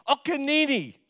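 put-on high little-girl chant; high, insistent toddler imitation. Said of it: "like this".

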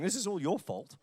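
normal talking voice

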